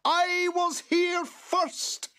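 very high, drawn-out furious screech; raging boggart. A narrator's reading. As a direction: screech it slowly in a tantrum. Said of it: "jump up and down in a rage".

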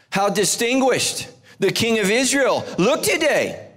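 high-pitched, mildly scornful reading; disgusted. A narrator's reading. Michal scolds David for dancing half-dressed, disgusted. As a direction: read it out with pointed disgust. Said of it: "she said in disgust".

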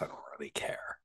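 whisper. Real, not acted. Dismissive aside about the role.

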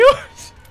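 shrill laughing squeal; high squeal laugh. Real, not acted. Laughs at own crying story.

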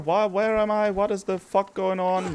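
high, strained, agitated questions; panicked high-pitched demand for bearings; flustered outburst. Real, not acted. Lost player cries out at the game, flustered.

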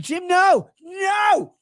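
high pleading yell. Retold or imitated. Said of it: "I'm like".

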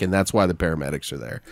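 normal talking voice

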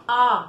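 "आ" as a held, open long vowel; pronunciation demo. Shown as a demonstration. Teacher showing children how to pronounce, "आ" held long. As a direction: draw out "आ" clearly as a long aa. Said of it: "कैसे उच्चारण करना है".

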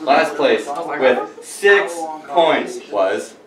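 slow drawn-out reveal; suspense reveal. Acted. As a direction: drag it out before the name. Results reveal.

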